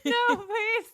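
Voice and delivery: high squeal close to tears; helpless laughter. Real, not acted. Begging for mercy from the joke, helpless high laughter. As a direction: plead through real laughing.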